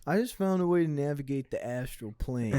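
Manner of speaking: laid-back stoner lecturing a friend. Retold or imitated. Acting out the stoner's line.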